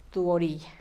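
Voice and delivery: normal talking voice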